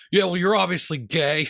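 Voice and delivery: taunting commenter. Retold or imitated. Imitates an online commenter's taunt.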